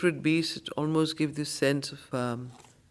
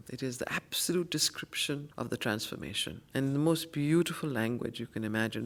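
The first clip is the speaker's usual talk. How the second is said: quick unvoiced whisper; 4 semitones lower, 6 dB quieter than usual. Real, not acted. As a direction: murmur it in awe. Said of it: "whispered praise".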